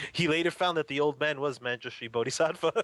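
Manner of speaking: real laugh breaking into the sentence; amused punchline. Real, not acted. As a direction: deliver the twist on the edge of laughter.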